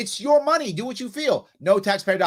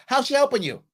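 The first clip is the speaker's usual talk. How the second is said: ordinary talk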